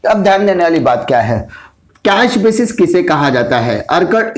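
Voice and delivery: ordinary delivery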